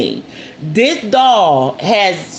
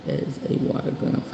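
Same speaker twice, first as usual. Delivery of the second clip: breathy whisper, much softer and lower; whispered aside; 12 dB quieter, faster than usual. Real, not acted. Whispering an aside to someone off camera. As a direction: drop to a whisper for a quick aside.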